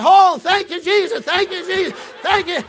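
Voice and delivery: loud, high, jubilant thanks; bouncing with joy. Retold or imitated. Overjoyed woman hugging the preacher, shouting thanks. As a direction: whoop out thanks while bouncing. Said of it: "she started bouncing up".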